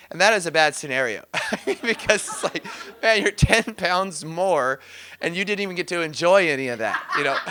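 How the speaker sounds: laughing while talking; chuckling through the words. Real, not acted. Laughing retelling.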